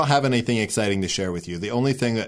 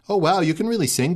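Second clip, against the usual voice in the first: gushing friend; 10 semitones higher, 5 dB louder, faster than usual. Retold or imitated. Friend's gushing compliment retold.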